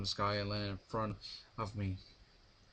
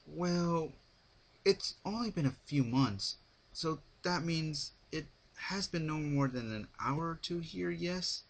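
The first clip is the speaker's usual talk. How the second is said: serious question; 7 semitones higher than usual. A narrator's reading. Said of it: "I asked now in all seriousness".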